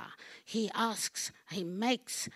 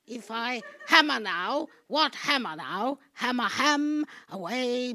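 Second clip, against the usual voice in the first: rising frenzied outburst; 4 semitones higher, 6 dB louder than usual. Acted. Building to a frenzy.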